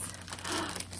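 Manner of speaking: breathy gasp of delight; soft gasp. Real, not acted. Opening Pam's package, gasping softly. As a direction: catch your breath at something pretty.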